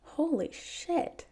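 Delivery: high, stretched slow amazed exclamation; drawn-out shocked awe; stunned disbelief. A narrator's reading. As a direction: gasp it out floored by the news. Stunned by gossip.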